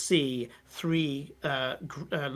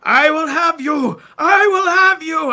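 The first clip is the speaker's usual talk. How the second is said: full-force, high screamed war cry; screaming; 14 semitones higher, 17 dB louder than usual. Acted. Possessed general screaming as he attacks the aboleth, frenzied scream. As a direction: scream it out high, drawing out the words. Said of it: "he's there screaming".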